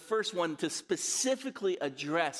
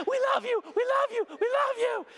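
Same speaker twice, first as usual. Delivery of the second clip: very high, squeaky panting-dog voice; pant, then squeal adoringly like two overjoyed little dogs; excited dogs; 5 dB louder than usual. Acted. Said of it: "they're just like".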